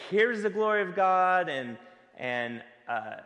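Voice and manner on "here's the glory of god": louder and higher, proclaiming it with pomp; hammed-up herald's proclamation; mock grandiose announcement. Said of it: "this grandiose reveal".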